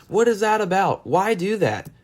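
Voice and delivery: high, baffled question; can't believe people do that; incredulous. Real, not acted.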